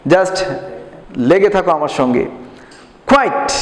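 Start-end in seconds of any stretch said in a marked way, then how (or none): 3.1-3.5 কোয়াইট said clearly as kwait, ending in -ait; pronunciation model. Shown as a demonstration. Teacher modelling কোয়াইট for pupils to repeat. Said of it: "আমার সাথে উচ্চারণ বলে নাও".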